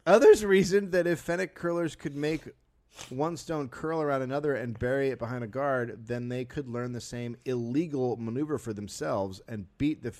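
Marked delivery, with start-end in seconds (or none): 0.1-5.2 dramatic reading. Acted.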